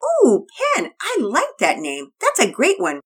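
high, delighted mom voice; a mother praising the name; Mama Peters. Acted.

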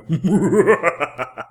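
villain cackle, high and loud; maniacal laugh. Shown as a demonstration. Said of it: "a maniacal laugh?".

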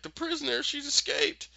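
high, hammed-up cry of alarm; mock alarm. Trapped Miranda reporting the prisoner's escape, mock alarm. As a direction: raise the alarm in a high imitation voice.

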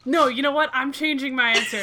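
sudden burst of glee; excited. Real, not acted. Sudden change of mind.

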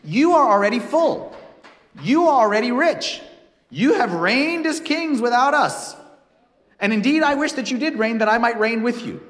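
loud, mocking sarcasm, high and pointed; reading Paul's words as a loud sarcastic dig. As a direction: jab at the proud listeners with mock praise. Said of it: "Everything he says here is sarcastic".

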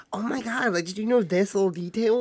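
lightly mocking fan voice; gushing Disney adult. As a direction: imitate an overeager Disney fan. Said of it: "Disney adults just being like".

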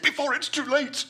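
high, pleading voice of a scared man; frightened Winthrop. Acted. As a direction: beg to be pulled up, panicking. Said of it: "he said".

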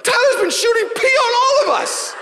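very high, loud whining shout; kids wailing. Retold or imitated. Kids tattling on Tyler, high wailing shout. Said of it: "everyone's like".

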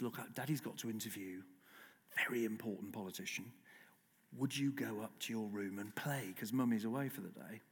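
put-on soft, low voice explaining to small children; daddy talking to toddlers. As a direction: gently coax the little ones, hushed and low. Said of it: "that's how I talked to my kids".

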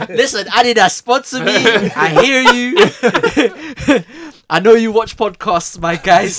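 laughing loud mock appeal to a brand; laughing plea. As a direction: call out to the sponsor through laughter. Begging Adidas for sponsorship, laughing.

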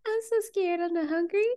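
high, soft imitation whine; whiny. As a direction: mimic a scared little complaint. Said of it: "she's still whining".

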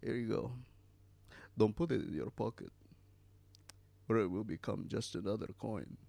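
lightly voiced villain, quick phrases between long pauses; hint of a quietly odd killer; Anton Chigurh. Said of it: "gas station attendant apparently guesses correctly".

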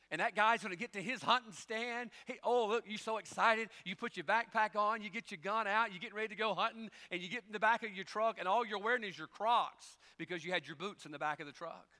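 loud, high, driving sermon delivery; preaching at full force. Acted. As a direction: preach it out, building energy. Preacher builds up the story.

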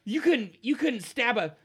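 high, loud taunt; hot-headed halfling mocking a rival; scornful halfling. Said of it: "He says".

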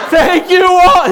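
high, loud laughing thanks; grateful cry. Retold or imitated. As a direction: burst out in relieved gratitude. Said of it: "And I'm like".

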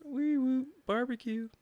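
high wailing wee woo, then barbecue; car alarm imitation. At the closed drive-through window, high imitated car-alarm wail. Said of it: "turn your car alarm on".

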